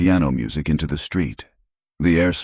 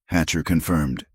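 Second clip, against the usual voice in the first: plain reading voice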